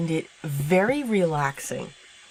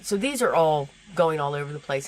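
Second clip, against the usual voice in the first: normal talking voice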